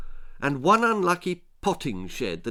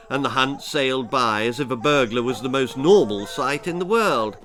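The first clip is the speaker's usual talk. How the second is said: ordinary delivery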